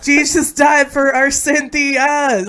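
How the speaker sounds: high, loud, slow proclamation; angry slam poetry. Acted. Friend performing the Cynthia poem, angry slam poetry. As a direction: drag out the yelled line like a slam poet. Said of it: "Yelling. Angry".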